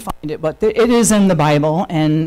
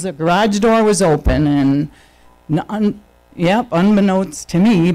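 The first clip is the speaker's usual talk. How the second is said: normal talking voice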